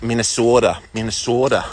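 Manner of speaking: imitated Minnesota accent on the state name; lightly mock Minnesota accent. Walker recalling Fargo, trying a Minnesota accent. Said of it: "hear a Minnesota accent".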